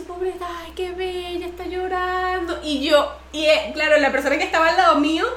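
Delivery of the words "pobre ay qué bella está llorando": high, soft, slow cooing; hushed mock-tender singsong, last word stretched; tender pity, imitated. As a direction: play the sympathetic old ladies fussing gently. Old ladies comfort her in church.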